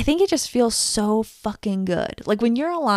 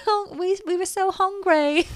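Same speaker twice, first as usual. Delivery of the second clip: high, pleading cartoon bear; whiny hungry bear; 11 semitones higher than usual. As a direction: beg like a starving bear. Bear voice in a joke.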